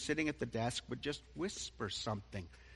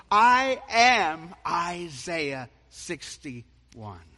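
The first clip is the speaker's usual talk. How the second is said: declaring; 2 semitones higher, 8 dB louder than usual. Acted. Jesus declares he is Messiah.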